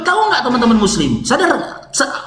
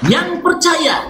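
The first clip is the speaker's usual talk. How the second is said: preacher's call; 7 semitones higher, much slower than usual. Real, not acted. Preacher calls for congregation response.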